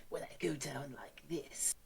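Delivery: low whispered villain voice; anime villain whisper. Shown as a demonstration. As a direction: breathe it out like a menacing villain. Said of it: "my anime villain voice is".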